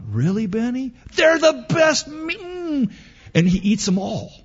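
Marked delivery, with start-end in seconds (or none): 0.1-2.9 put-on high, surprised child voice; doubtful little girl. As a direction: ask it like an envious kid sister, up high. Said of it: "Mary looks at the meatballs".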